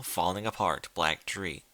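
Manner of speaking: ordinary delivery, nothing marked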